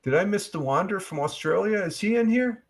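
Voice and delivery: asking the chat up high, curious; high searching call. Real, not acted. Looking for a viewer in chat.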